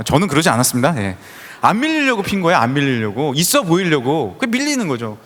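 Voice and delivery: ordinary talk, nothing marked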